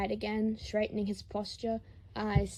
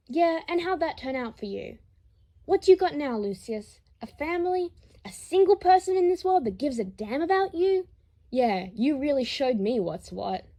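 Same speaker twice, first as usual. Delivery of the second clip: higher and louder, mocking fury; bitter taunt; 5 semitones higher, 8 dB louder than usual. Harry lashing out at Lucius, loud bitter taunt. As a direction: rub his nose in it, loud and high.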